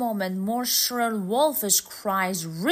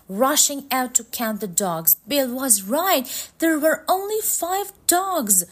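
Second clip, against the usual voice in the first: plain reading voice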